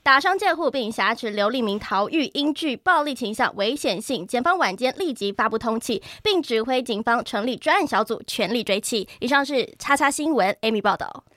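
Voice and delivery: brisk, formal newsreader delivery; news report. Acted. Reporter to viewers about an escaped prisoner, formal news read. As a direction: read it like a breaking news bulletin.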